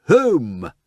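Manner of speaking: loud command; cry. Acted. Lord Peter cries to the taximan, loud. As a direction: order the driver home.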